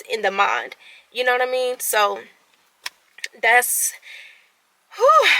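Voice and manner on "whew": sharp, high nervous whew; a jittery high-pitched breath out; anxious exhale. Real, not acted. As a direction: blow out the nerves. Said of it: "I'm anxious and I'm sweating".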